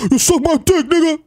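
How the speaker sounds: high, silly prank voice; goofy put-on kid's taunt; weird voice. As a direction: heckle in a squeaked-up disguise. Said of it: "in a weird voice".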